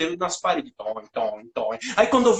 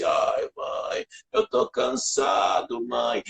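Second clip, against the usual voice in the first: tired whine; 8 semitones lower, 4 dB quieter, much slower than usual. Retold or imitated. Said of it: "fui tomar tudo torto".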